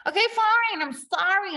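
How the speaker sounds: high, loud, huffy okay fine; mock-exasperated, forced apology; exasperated grudging sorry. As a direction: snap it out like a cornered boss forced to apologize. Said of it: "The power play is".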